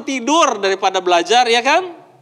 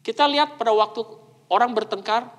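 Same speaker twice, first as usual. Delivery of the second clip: normal talking voice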